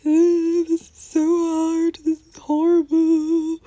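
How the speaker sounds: lightly acted heartbroken moan; a touch of imitated sobbing; mock crying. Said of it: "you're crying and you're like".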